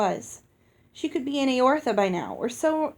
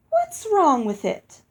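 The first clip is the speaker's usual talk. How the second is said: higher and louder, slow and challenging; defiant fairy; 7 semitones higher, 6 dB louder, slower than usual. A narrator's reading. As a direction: push back defiantly. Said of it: "thrust her head forward defiantly".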